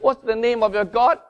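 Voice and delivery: high, loud, fervent preaching; preaching at full force. Acted. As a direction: preach it out with passion. Preaching with rising intensity.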